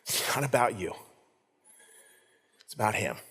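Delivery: a father speaking kindly, low and soft. Retold or imitated. Father kindly correcting his son, soft and low. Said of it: "very kindly saying".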